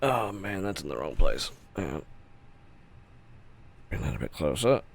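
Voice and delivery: ordinary talk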